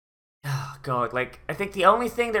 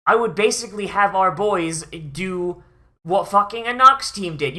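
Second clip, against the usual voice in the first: ordinary talk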